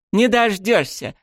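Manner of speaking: put-on high, slow, taunting girl's retort; defiant shout. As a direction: tease him like a cheeky girl. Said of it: "выкрикнула Миями".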